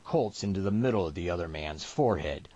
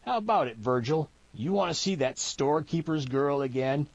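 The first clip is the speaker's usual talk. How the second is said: higher, leering backwoods voice; goading mountain man; 6 semitones higher than usual. Acted. Mountain man goading his son Virgil, high and cocky. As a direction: egg him on, pitched up.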